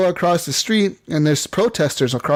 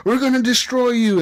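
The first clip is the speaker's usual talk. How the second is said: high-pitched mob threat, not shouted; mock threatening protester; 9 semitones higher than usual. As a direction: imitate an attacker threatening the robot. Protesters attack the robot.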